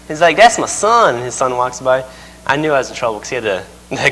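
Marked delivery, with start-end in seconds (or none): 0.4-1.1 country preacher imitation. Imitates preacher introducing his son.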